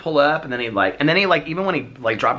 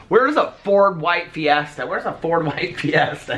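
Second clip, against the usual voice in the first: higher, drawn-out mock questioning; imitating repeated question; 6 semitones higher, slower than usual. Host imitating Alex hunting for the car, drawn-out questioning. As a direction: imitate a friend asking it over and over. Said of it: "He kept on saying".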